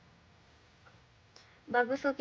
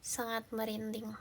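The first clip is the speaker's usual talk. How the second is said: whisper; 2 semitones lower, 7 dB quieter than usual. Real, not acted. Whispered reaction to chat.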